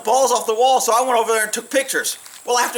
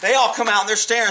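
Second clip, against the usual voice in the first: usual speaking voice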